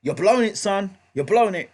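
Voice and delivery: retold cornerman shouting at a boxer falling behind; corner trainer yelling. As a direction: bark it at a fighter on his stool. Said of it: "he said to him".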